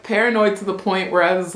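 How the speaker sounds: normal talking voice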